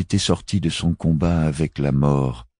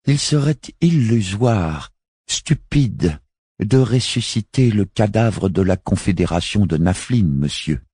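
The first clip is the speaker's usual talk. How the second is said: articulating each syllable; 8 semitones higher, 3 dB louder than usual. Acted. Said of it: "dit-elle en articulant chaque syllabe".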